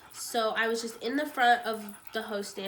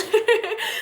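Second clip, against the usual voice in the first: tearful laugh; 10 semitones higher, 10 dB louder than usual. Real, not acted. Breaking down while telling news.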